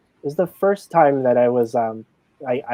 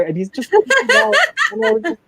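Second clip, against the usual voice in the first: laughing. Real, not acted. Laughing while telling funny story.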